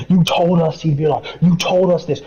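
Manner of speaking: imitating angry grumblers; mock complaining crowd. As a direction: throw the complaint back at him. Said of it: "they were complaining and groaning".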